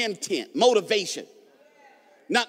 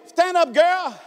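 raised, higher call to stand; emphatic shout-out; 8 semitones higher, 6 dB louder, much slower than usual. Acted. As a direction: call out a playful order. Calling out a playful order.

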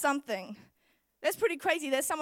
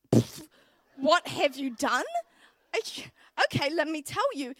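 sassy retort; 4 dB louder than usual. Retold or imitated. Confronting dad with sass.

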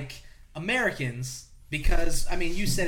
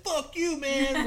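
high-pitched mock cursing; mock angry outburst; 10 semitones higher, much slower than usual. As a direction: act out a movie character cursing someone off. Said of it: "And it's just like".

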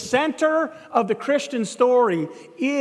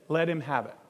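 soft, lowered, resigned reply; retold quiet concession; 5 semitones lower, 6 dB quieter than usual. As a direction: LET HIM HAVE IT said low and soft. Student outwardly gives up the desk.

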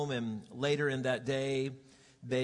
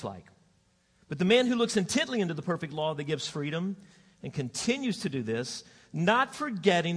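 scripture reading; 3 semitones higher than usual. Acted. Reads scripture aloud.

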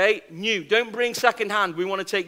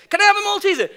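pestering whiny nagging; 11 semitones higher, 6 dB louder, much faster than usual. Retold or imitated. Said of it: "She must have asked me five times".

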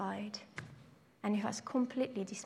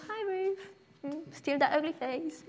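teasing classmates; 5 semitones higher than usual. Retold or imitated. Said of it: "they were just like".

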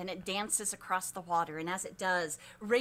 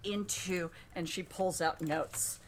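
slow, hushed oracle voice; slow oracle chant with long pauses; prophecy recital; 4 semitones lower, slower than usual. Acted. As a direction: intone each phrase slowly.

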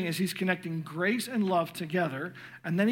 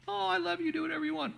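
permissive parent; 11 semitones higher, much faster than usual. Retold or imitated. Parent too lenient, mocking tone.

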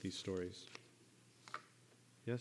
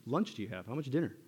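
rising playful questions, building; higher and louder by the end; Jesus teasing; 5 semitones higher, 3 dB louder than usual. Retold or imitated. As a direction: prod them with a grin. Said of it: "he's like".

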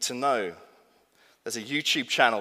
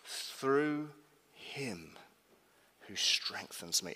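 each word set apart with pauses; deliberate, weighty restatement; slow, spaced emphasis; 3 semitones lower, 7 dB quieter, much slower than usual. Shown as a demonstration. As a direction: lean on "Through" and let "him" drop away. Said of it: "There you go".

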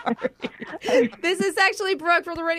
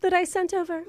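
quick, higher-pitched mock British; hammed-up British accent; fake British accent; 4 semitones higher, faster than usual. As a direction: put on a fussy British applicant. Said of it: "I'm a little surprised by your accent".

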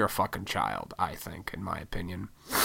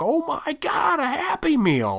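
put-on much higher, thrilled child squeal; excited kid; 4 dB louder than usual. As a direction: gasp it out like a kid given a treat. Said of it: "I was so fucking excited".

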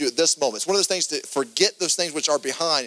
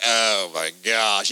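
dismayed groan; 7 semitones lower, much slower than usual. Retold or imitated. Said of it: "And she's like".

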